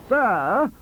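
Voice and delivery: high, loud counter of one word; loud retort. A narrator's reading. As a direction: fire it right back. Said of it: "countered Professor".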